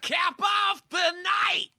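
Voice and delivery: very high slow shout. Pretend. Mocking echo.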